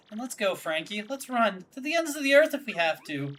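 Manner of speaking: put-on a swooning girl begging for love; Francesca pleading. Daughter pleading with her boyfriend, high and soft.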